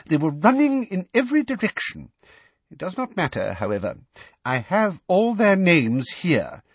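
high prim self-important voice; smug officious account; Umbridge voice. A narrator's reading.

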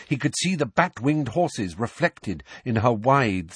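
ordinary delivery, nothing marked

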